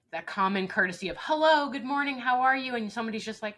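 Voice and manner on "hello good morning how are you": much higher and louder, bright sing-song hello; cheery greeting. Retold or imitated. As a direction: overdo the chirpy polite greeting. Mock cheery routine greeting.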